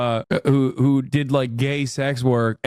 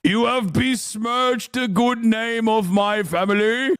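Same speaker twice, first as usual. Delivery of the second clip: higher, slow mock noble voice with a laugh in it; medieval peasant; 7 semitones higher, slower than usual. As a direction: play an outraged lord, half laughing. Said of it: "a medieval peasant take".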